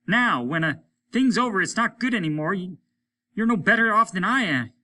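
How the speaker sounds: high, loud desperate cry; cried out. A narrator's reading. Hollis cries out to Lesper in despair, loud. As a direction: hurl the question at him. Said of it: "he cried to Lesper".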